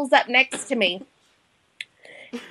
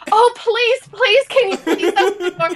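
pleading; 7 semitones higher than usual. Retold or imitated. Begging stranger to unlock car.

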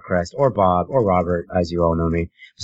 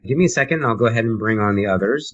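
usual speaking voice

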